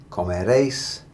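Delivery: comeréis with emphasis on réis; comeréis, rising onto a strong réis; final-syllable stress. Shown as a demonstration. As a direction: punch the last syllable of comeréis. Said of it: "stressing that very last syllable".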